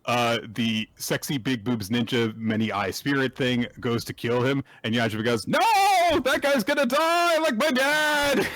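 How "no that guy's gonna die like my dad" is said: hammed-up high plea; frantic hero. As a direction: wail it like a scared anime boy. Voicing Yajima again.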